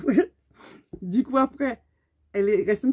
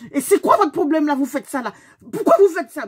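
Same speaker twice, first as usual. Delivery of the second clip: higher, loud raging questions; enraged confrontation; 5 semitones higher, 6 dB louder than usual. Retold or imitated. Demanding answers from the driver, enraged and loud. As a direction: lay into her, enraged. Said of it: "j'étais enragée".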